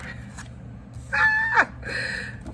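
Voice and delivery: sharp high squealing laugh; short high-pitched cackle. Real, not acted. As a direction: break into a shrieking laugh. Laughs again.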